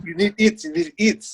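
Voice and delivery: mock Russian accent, insisting. Coach ordering his bodybuilder to eat, imitated in a Russian accent. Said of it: "in, like, a Russian accent".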